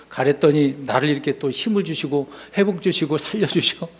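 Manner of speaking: ordinary talk, nothing marked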